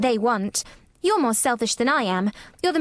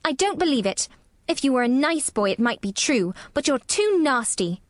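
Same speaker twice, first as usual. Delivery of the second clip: put-on higher, scolding child voice; sour; 5 semitones higher than usual. A narrator's reading. Girl accuses sick boy of faking, sourly. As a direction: unsympathetic little girl telling him off. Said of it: "said Mary sourly".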